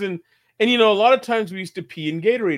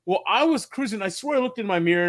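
ordinary talk

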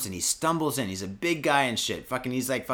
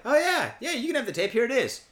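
high-pitched, agreeable mock boss; breezy boss; 8 semitones higher than usual. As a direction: act out a boss suddenly happy to oblige. Boss cheerfully hands over tape.

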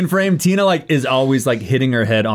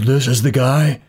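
Tina's voice; slower than usual. Acted. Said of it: "And she goes".

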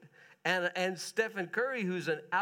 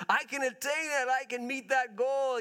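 higher, bold mock confidence; mock boast; 7 semitones higher, 4 dB louder than usual. Preacher voicing an athlete's boast, higher and bold. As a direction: sell it like a pumped athlete.

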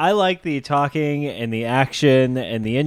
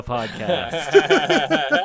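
laughing; 12 semitones higher than usual. Real, not acted. Teasing about spell checks.

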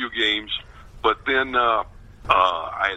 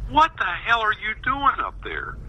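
higher-pitched imitation of a bewildered father; mock exasperated dad; 10 semitones higher than usual. Dad phoning his son about the story, mock exasperated. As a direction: demand an explanation, lightly playing the dad.

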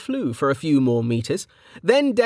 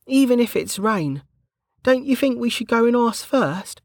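higher, careful worried guard; Bregger's voice; 13 semitones higher than usual. A narrator's reading. Bregger warning Kettle about the citizen, anxious. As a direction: voice the nervous rule-follower.

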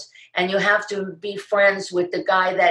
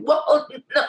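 high-pitched inner cry of dismay; mock alarm, voice jumping up; comic silent panic voiced aloud; inward panic; 10 semitones higher than usual. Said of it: "Of course, inside I'm going".

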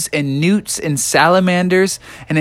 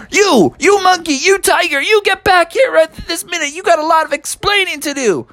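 high, shrieking frog telling them off; a cross little frog giving chase; squeaky scolding frog. Acted. Froggy yells at fleeing monkey and tiger, high scold.

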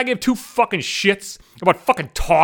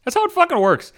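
much higher, HOW hammered hard; emphatic high repeat; 11 semitones higher than usual. Real, not acted. Telling off the defenders, a high emphatic repeat. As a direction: fire it back up, insisting. Repeats the line in two deliveries.

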